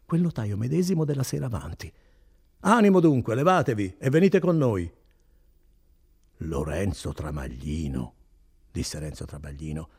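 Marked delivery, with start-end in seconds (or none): 2.6-4.9 loud, raised order; commanding. Acted. Notary orders Renzo out of bed, commanding. 6.4-8.1 slow, low, puzzled repetition; dazed echo. Acted. Just-woken Renzo repeats his own name, dazed.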